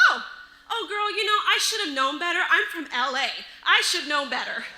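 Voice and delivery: restroom woman. Retold or imitated. Woman excusing her dirty look, loud chummy imitation. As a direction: gush it out loudly, girl to girl. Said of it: "She says".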